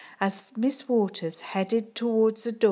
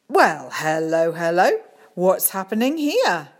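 loud, hearty head teacher; cheery voice; 8 dB louder than usual. Acted. Head teacher walks in on them, loud and cheery. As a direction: boom out a jolly greeting. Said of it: "Mister Bryan's cheery voice rang out".

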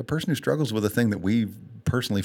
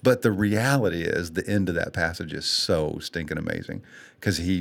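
normal talking voice

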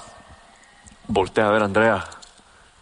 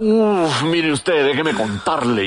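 put-on higher, louder drunken gushing; drunk friend; 6 semitones higher, 3 dB louder, much slower than usual. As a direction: gush like a tipsy friend bragging. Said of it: "borrachísimo".

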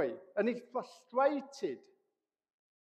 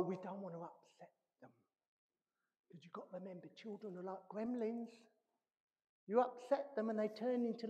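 quiet ironic aside; 9 dB quieter than usual. Real, not acted. Ironic aside after shout.

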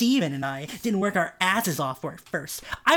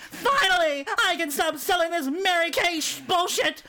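put-on high young woman's voice, exasperated; gasped relief; 10 semitones higher than usual. A narrator's reading. Young woman quitting Mary Kay, high relieved gasp. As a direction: gasp it out high like a fed-up girl. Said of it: "gasped a young Robinson".

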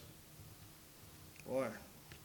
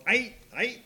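higher, louder mock street greeting; sister on the phone; 7 semitones higher, 6 dB louder than usual. Imitating his sister answering the phone with aight. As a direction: toss off aight aight like a phone brush-off. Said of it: "She does that all the time, talking on the phone".

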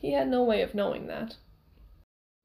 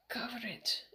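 weak, barely voiced whisper; faint answer; 10 dB quieter than usual. A narrator's reading. Wounded pupil answers his teacher, faint whisper. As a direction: whisper it with no strength left.

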